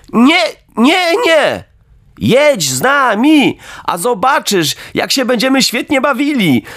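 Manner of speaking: put-on high, excited children's voices; chorus of kids. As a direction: cheer from inside the cart. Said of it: "zawołały jakieś głosy".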